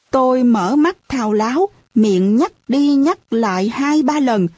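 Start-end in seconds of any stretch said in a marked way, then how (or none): none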